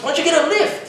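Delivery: very high mock suggestion; nagging girl. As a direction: imitate pushy advice in high pitch. Imitates the pestering girl.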